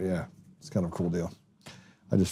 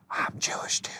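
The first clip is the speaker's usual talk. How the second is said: hushed, whispered mock confession; confiding whisper; 8 dB quieter than usual. Host imitating friends confiding their background, secretive whisper. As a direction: whisper it like a secret being let slip.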